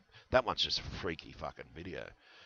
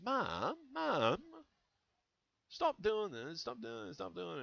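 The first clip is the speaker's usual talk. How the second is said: imitating a child begging, higher and softer; a pleading kid voice, pitched up; 13 semitones higher, 4 dB quieter, slower than usual. Child begging mum to stop, in a higher, softer mock child voice.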